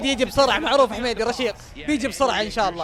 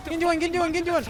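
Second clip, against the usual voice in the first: ordinary talk, nothing marked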